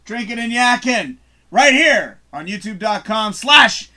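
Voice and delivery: loud, high hosting voice; announcer sign-off. Acted. Host signing off the show in a loud announcer voice. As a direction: boom out the show's outro.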